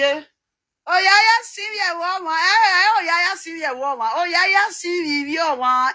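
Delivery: high, drawn-out calling; theatrical exclamation. Acted. Exclaiming over this woman to the viewers, high theatrical cry. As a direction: exclaim it high with stretched vowels.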